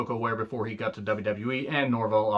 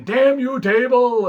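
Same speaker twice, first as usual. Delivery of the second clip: much higher, louder mock heel shout; over-the-top cartoon villain yell; hammed-up wrestler rage; 12 semitones higher, 8 dB louder, slower than usual. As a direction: ham up a sore loser cursing the table. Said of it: "I don't know why he would talk like that".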